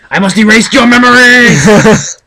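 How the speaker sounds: hammy panic to wipe their memory; mock villain shout. After revealing his hometown, mock villain shout. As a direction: shout it high and loud like a mad villain. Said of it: "I just gave away where I lived".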